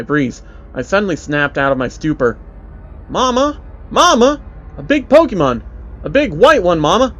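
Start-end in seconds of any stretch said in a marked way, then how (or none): 3.1-7.1 hollering. A narrator's reading. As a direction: yell for Mama, pointing, excited. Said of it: "I hollered".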